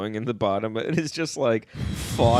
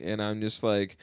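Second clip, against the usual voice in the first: ordinary talk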